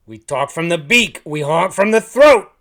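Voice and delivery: very loud, high goose declaration; huffy goose. A narrator's reading. Huffing goose explaining anatomy to the hunter, loud holler. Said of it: "He huffed and he puffed".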